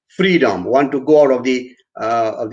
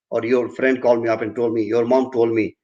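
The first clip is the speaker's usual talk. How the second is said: usual speaking voice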